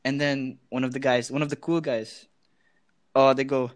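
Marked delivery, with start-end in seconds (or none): none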